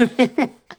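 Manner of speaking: high laugh. Real, not acted. Host laughing about the marionettes line. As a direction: burst out laughing.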